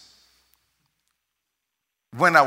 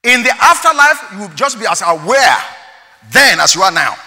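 loud, high sermon delivery; full-throated preacher's call; preaching at full force; 8 semitones higher, 14 dB louder than usual. Acted. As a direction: drive it home to the congregation.

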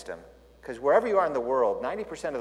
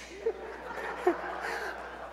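high laugh; 7 dB quieter than usual. Real, not acted. Laughing at own punchline.

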